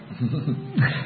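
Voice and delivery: brief soft laugh; quiet amused chuckle. Real, not acted. Laughs recalling a story.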